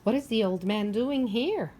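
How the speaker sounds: ordinary delivery, nothing marked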